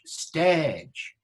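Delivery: "stage" said as "stehdge"; original-pronunciation vowel. Shown as a demonstration. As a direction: hold one pure vowel in "stage", no glide. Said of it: "Where do people say that?".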